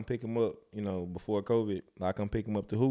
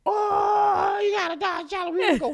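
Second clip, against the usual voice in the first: high, loud, giddy car hype; mock the reaction he should have had; hyped-up imitation; 8 dB louder than usual. Stepdad imitating the hoped-for hype, high excited voice.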